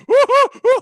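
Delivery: high, loud cartoon whooping laugh; exaggerated hooting laugh; imitated cartoon laughter. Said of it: "like he's cartoon fucking laughing".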